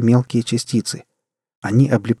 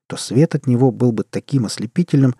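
usual speaking voice

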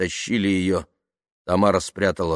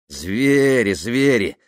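insistent repetition; 4 semitones higher, 5 dB louder, much slower than usual. A narrator's reading. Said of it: "повторяла она".

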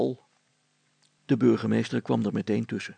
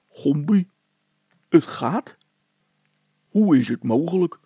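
ordinary delivery, nothing marked

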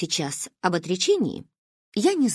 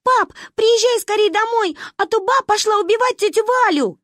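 put-on high, loud panicked child; 13 semitones higher, 5 dB louder than usual. A narrator's reading. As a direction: beg dad down the phone. Panicked call to father. (паническая мольба)

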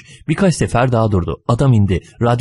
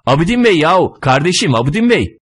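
high, exasperated hailing; insistent calling out; 8 semitones higher than usual. A narrator's reading. Stranger calls after the runner who pays no heed, insistent. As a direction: keep calling after someone who ignores you. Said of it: "Arkamdan biri bağırıyor".

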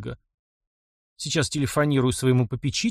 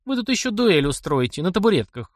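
higher-pitched female character, lightly derisive; mocking; 7 semitones higher than usual. A narrator's reading. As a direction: tease them with playful scorn. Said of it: "раздался насмешливый голос".

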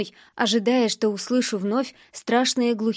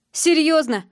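high, louder mocking question; high, louder mocking scoff; smirking; 5 semitones higher, 5 dB louder than usual. A narrator's reading. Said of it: "усмехнулся зверь".

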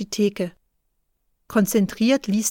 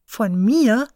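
überrascht fragend, „mir“ etwas höher und lauter; ungläubig nachfragen; 3 dB louder than usual. A narrator's reading. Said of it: "sagt Juni überrascht". (überraschtes „Von mir?“)

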